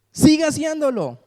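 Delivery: high-pitched, emphatic urging; urging encouragement. Retold or imitated. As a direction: urge them on in a high, emphatic voice. God urging the people on.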